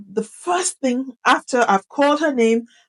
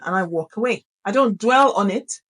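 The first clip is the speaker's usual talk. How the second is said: ordinary talk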